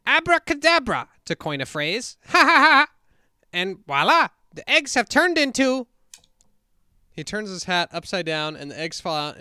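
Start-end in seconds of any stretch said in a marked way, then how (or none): 0.1-5.9 high, loud bumbling magician; hammy stage conjuror flourish; Professor Hinkle. Acted. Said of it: "I tried to listen to some Hinkle earlier today to get this".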